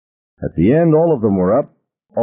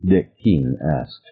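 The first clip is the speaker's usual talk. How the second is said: usual speaking voice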